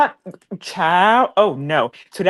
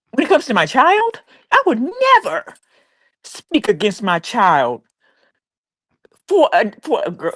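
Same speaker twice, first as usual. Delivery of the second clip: emotional emphasis; 4 semitones higher than usual. Real, not acted. Woman vents about a mother turning on her child, voice rising then slowing. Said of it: "I don't know why I'm getting this emotional".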